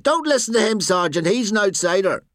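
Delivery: high-pitched heckle; calling from a distance. A narrator's reading. Bystander to the sergeant from a distance, calling out. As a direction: call it across from far away. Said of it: "Someone from a distance said".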